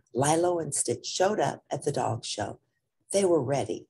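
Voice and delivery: ordinary delivery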